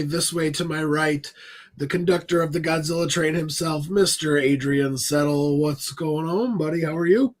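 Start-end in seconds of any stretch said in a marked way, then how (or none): none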